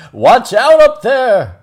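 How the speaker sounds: loud high warning call; cry out. Acted. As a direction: shout up a warning. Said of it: "good Trevor did cry".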